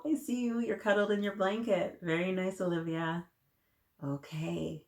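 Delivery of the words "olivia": ordinary delivery, nothing marked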